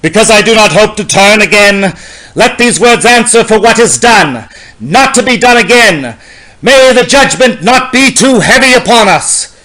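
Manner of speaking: usual speaking voice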